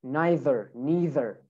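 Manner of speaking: American "neither" two ways, "nye-ther" then "nee-ther", R sounded; American accent. Shown as a demonstration. Teacher models American "neither" both ways, final R sounded. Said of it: "we add the R".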